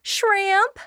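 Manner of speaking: triumphant announcement. Retold or imitated. Blanche announcing the appetizer to her guest, in a high, triumphant cry. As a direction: sing out Shrimp like a grand hostess. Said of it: "she announces the appetizer".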